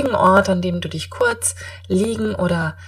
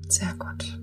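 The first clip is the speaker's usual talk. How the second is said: guided meditation; 9 dB quieter than usual. Acted.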